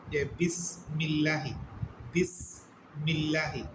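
বিসমিল্লাহি recited slowly with a thin, light লা; slow demonstration. Shown as a demonstration. Teacher showing students the thin লাম, slow and deliberate. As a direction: draw out বিসমিল্লাহি so the thin লা in লাহি is heard. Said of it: "এই যে এখানে দেখেন".

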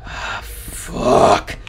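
breathy, voiceless swearing; whispered curse. Real, not acted. Gamer to himself in the horror game, whispered curse. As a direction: whisper the curse under tension.

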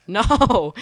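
loud high laughing protest. Real, not acted. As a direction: laugh out a despairing no. Killed again, laughing.

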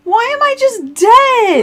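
high, drawn-out wail; hammed-up dramatic lament; mock despair. Player protesting own death in game, mock despair.

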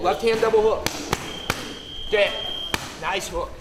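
ordinary delivery

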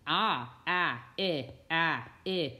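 "Ah, eh" as the two short vowels of "napkin", each on its own; separate, evenly spaced "ah", "eh" vowel sounds; isolated vowel sounds. Shown as a demonstration. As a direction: sound out "ah" as in nap and "eh" as the short ih in kin. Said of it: "I hear two vowel sounds".

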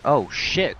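usual speaking voice

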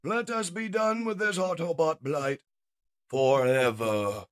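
loud, high theatrical menace; villain's decree. Acted. Shockwave moves to finish the Autobots, menacing. Shockwave orders the final attack.

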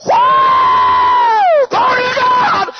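very high, loud ecstatic hollering; rebel yell. Retold or imitated. Storyteller joining his friend's shouting in the car, wild rebel yell. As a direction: let loose with a full-throated whoop. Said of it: "let out a rebel yell".

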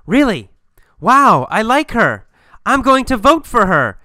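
high, loud delighted surprise; excited. Acted. Julia hears who her ex is dating, excited approval. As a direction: gush with big, swooping enthusiasm.

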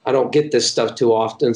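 normal talking voice

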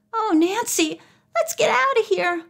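very high, hushed-style plea; anxious whisper. A narrator's reading. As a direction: urge a friend away nervously. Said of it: "Bess whispered".

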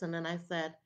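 ordinary delivery, nothing marked

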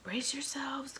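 high, loud warning; shout from above. A narrator's reading. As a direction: yell the warning down. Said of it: "came the shout from above".

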